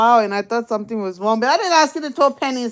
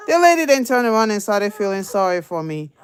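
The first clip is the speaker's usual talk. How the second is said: ordinary talk, nothing marked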